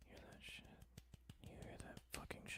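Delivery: hushed whisper. Acted. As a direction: breathe it out in a whisper. Whispering aside.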